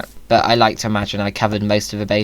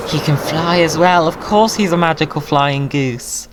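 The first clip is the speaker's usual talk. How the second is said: awed excitement; 6 semitones higher than usual. Real, not acted. Marvelling at the flying goose.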